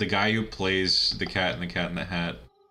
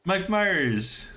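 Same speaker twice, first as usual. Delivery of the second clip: starstruck fan greeting; 10 semitones higher, 3 dB louder than usual. Pretend. Pretending to meet Mike Myers, gushing high and excited. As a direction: gush like a fan meeting his idol. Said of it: "like, basically say".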